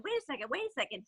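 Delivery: quick thought. Retold or imitated. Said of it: "he's thinking".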